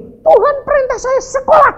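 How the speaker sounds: high, loud outburst of a command; mock-urgent high shout; blunt announcement. Shown as a demonstration. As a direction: blurt it out far too forcefully. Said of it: "Jangan langsung gini".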